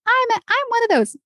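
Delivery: very high-pitched voice of a worried would-be singer; self-doubting listener. Acted. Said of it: "if you're sitting here thinking".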